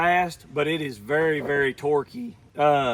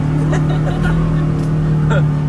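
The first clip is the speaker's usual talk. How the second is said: loud laugh; 7 dB louder than usual. Real, not acted. Laughing on the ride.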